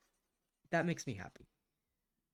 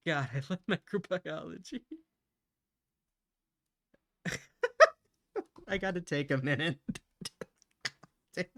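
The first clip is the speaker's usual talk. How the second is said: happy crying; 6 semitones higher, 7 dB louder than usual. Real, not acted. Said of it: "happy tears".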